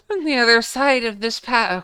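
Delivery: breaking into giggles mid-line; laughing through it. Acted. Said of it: "she sounds like an airy, airy British girl".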